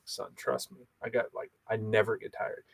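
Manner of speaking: a softly spoken dad reassuring his son; hushed reply to son. Retold or imitated. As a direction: lean in and tell him quietly.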